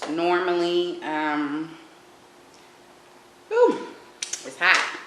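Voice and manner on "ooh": sharp squeal of surprise; a quick high yelp; surprised high ooh. Real, not acted. Sudden reaction to hot lobster.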